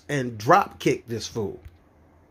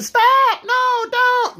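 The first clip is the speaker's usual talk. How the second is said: high, loud mock panic; mock scream; 4 dB louder, much slower than usual. Comic aside on silent riders, mock frightened scream. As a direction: squeal it like a scared passenger. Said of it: "they haven't screamed".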